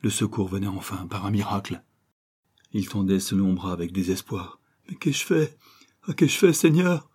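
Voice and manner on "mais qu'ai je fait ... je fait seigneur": high, anguished appeal, getting louder; horrified self-reproach, pitched up; despairing cry. A narrator's reading. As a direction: cry out in remorse. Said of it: "s'écria-t-il".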